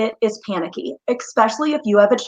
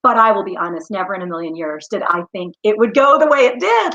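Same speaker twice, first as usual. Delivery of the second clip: ordinary delivery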